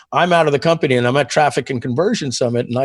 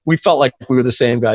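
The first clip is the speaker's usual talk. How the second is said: ordinary talk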